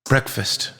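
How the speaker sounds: a soft, brief answer, lightly in character; older sister's reply. A narrator's reading. Chloe answering her disapproving sister, softly matter-of-fact.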